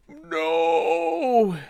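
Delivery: high, drawn-out mock cry of despair; mock dismayed cry. Streamer hams up dismay at bad quest news. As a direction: wail no like a hammed-up tragedy.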